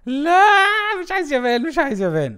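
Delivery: real high-pitched pleading shout; loud protest. Real, not acted. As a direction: cry out in dismay, then insist. Protesting an unwanted pack result.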